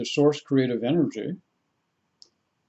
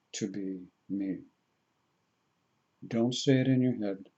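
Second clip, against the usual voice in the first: guided meditation; 3 semitones lower, 8 dB quieter than usual. Real, not acted. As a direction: lead them gently inward, with long pauses. Leads a meditation.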